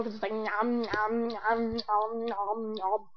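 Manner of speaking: playful nom nom chewing sounds; chewing noises. Shown as a demonstration. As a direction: smack your lips, nom nom. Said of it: "I really hope you can't tell".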